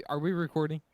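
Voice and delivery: ordinary talk, nothing marked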